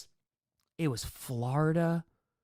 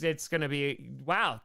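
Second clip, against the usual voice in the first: ordinary talk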